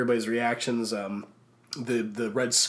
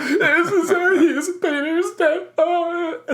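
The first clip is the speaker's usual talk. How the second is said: much higher, louder whimpering; mock sobbing wail; 6 dB louder than usual. Crying girl mourning Peter, imitated sobbing wail. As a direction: wail in hammed-up grief. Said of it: "She was sobbing and shaking".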